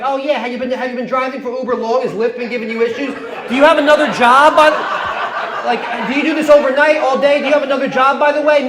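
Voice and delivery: put-on chatty girlfriend. Imitates girlfriend chatting up Uber driver.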